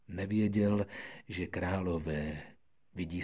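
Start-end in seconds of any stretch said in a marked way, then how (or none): none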